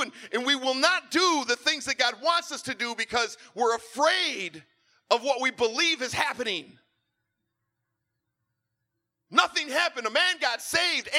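high, loud, driving sermon voice; full-force preaching. Acted. Pastor preaches to congregation.

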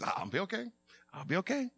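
very soft, small voice; meek reassurance. Retold or imitated. Husband answering his wife's concern, meek and hushed. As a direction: retell a subdued promise to cope.

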